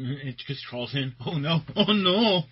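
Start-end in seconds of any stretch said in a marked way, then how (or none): 1.2-2.4 mock panic. Acted flea scene.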